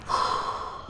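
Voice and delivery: soft exhaled sigh; heavy sigh. Real, not acted. Streamer giving up on the round, soft sigh. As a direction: let out a frustrated breath. Sighs after the rant.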